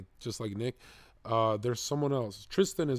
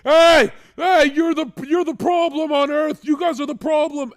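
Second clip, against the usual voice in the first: mock screaming protester; 14 dB louder than usual.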